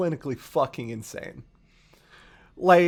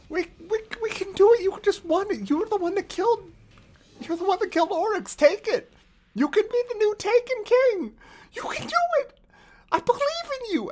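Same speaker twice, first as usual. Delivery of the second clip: much higher, frantic hype-man cheering; Toland cheering. Retold or imitated. Toland urging the Guardian to take Oryx's power, frantic high cheering. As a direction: egg the player on, getting higher and faster.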